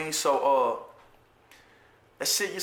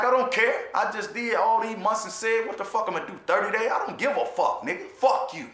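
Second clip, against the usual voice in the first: retold defiant outburst; 8 semitones higher than usual. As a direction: throw it back at him, fired up and high. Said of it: "I'm like".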